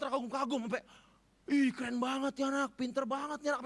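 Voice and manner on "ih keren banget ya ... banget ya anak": gushing, amazed and loud; amazed onlookers. Retold or imitated. A preacher voicing the crowd's amazement. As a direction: marvel at the boy out loud.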